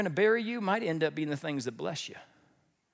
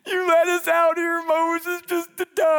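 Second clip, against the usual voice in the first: high, loud, drawn-out mock wail; hammed-up moaning; 10 semitones higher, 7 dB louder, slower than usual. The people complaining to Moses at the Red Sea, moaning. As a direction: wail and groan theatrically on a held high pitch. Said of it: "they moaned, they groaned".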